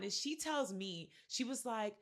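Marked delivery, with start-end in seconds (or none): none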